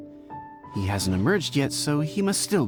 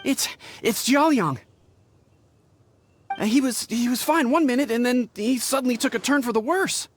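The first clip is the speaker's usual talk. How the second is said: breathless, higher-pitched urgent report; out of breath; 6 semitones higher than usual. Acted. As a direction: stumble into the bad news, short of breath. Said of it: "Just catch your breath".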